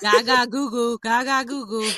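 light mock baby babble; baby talk. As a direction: coo it like a baby. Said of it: "I acted like a baby".

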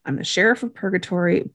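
usual speaking voice

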